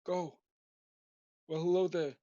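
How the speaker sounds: a lightly put-on odd voice for a hammy hello; mildly theatrical hello voice, not his own; weird greeting voice. Recapping how he opened his recording, a lightly weird voice. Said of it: "I start off my ramblings with a weird".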